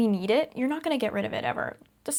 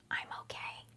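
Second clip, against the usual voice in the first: whispering; 13 dB quieter than usual. Real, not acted. Soothing whisper to self.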